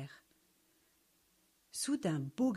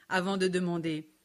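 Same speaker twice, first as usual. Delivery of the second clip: ordinary delivery, nothing marked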